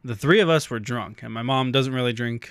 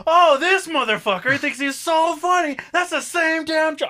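high-pitched, laughing comic rant; mock outraged yelling; 4 dB louder than usual. Drunk kid ranting at dad from back seat, mock outrage. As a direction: re-enact a hammed-up tirade, voice way up. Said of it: "I'm yelling at my dad".

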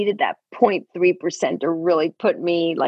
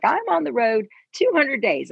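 higher, imitating the other person; mock higher voice of the traveler; person's voice; 8 semitones higher than usual. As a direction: pitch up to mimic them. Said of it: "this person's like".

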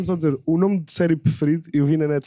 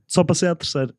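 ordinary delivery

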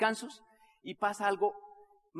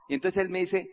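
normal talking voice

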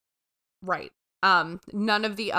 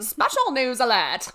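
mock news alert; 4 semitones higher, 7 dB louder than usual.

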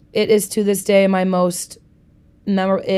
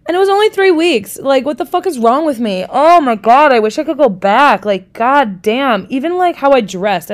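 higher and louder, disbelief at her younger self; real exasperated cry of what the heck; exasperated outburst; 5 semitones higher, 5 dB louder than usual. Real, not acted. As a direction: throw up your hands at yourself.

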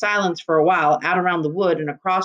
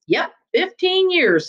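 boastful; 11 semitones higher, slower than usual. Acted. Constable boasts, chatty.

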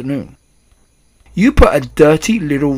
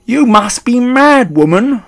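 high, loud, drawn-out shout; outraged cry; 11 semitones higher, 11 dB louder, slower than usual. A narrator's reading. Father disbelieving the grandmother, crying out in outrage. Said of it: "cried Mister Jenkins".

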